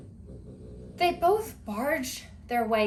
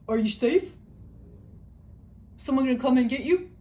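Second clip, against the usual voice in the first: lightly voiced guard dog, quick and a bit louder; voicing the dog; 2 semitones higher, 3 dB louder, faster than usual. Dog checking on owner in the shower. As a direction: play the dog worried for his owner. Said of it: "looking at me like".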